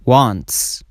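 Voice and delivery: "wants" ending in a hissed s after t; voiceless s ending. Shown as a demonstration. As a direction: finish "wants" on pure s, no buzz. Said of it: "S or E S is pronounced as s". («wants» با س بدون لرزه)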